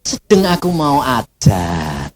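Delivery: slow, low, unhurried reply; retold voice of God. God answers the complaining preacher, slow low authority.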